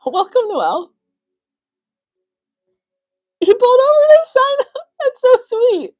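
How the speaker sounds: very high, loud squeal of delight; overjoyed, near tears. Real, not acted. Host touched that Noelle pulled over to sign up, squealing tearfully. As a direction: squeal it, overcome with happy emotion.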